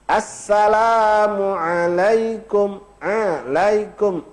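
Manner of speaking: Assalamualaikum and Alaikum very slow with a clear ain; ain clearly spoken. Shown as a demonstration. Ustaz repeating the ain so no one says a. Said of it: "Bukan a".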